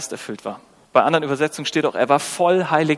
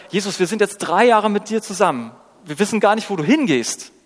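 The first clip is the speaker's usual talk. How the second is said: higher-pitched, insistent complaint; perplexed protest; 6 semitones higher, 3 dB louder than usual. Acted. Disciple to Jesus after three years together, perplexed protest. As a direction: protest in confusion, voice raised in pitch.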